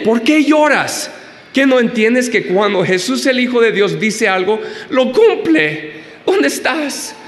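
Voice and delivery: high, bewildered angel voice; puzzled angels. Acted. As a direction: ask like baffled angels looking around. Angels baffled by Mary's tears.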